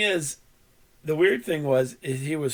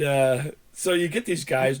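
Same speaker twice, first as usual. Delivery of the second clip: ordinary talk, nothing marked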